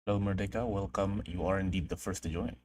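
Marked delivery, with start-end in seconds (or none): none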